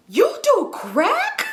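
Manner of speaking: high, drawn-out shocked question, breaking into laughter; incredulous disbelief. Retold or imitated. Storyteller confronting man making lines, high incredulous question. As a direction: retell it in slow, squealing disbelief.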